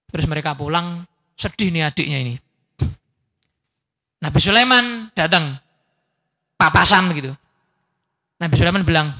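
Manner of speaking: ordinary talk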